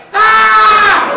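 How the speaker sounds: long, high, very loud scream; imitated shriek of fright, drawn out; mock terrified scream. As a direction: scream in comic panic. Imitates frightened friend as crowd gathers.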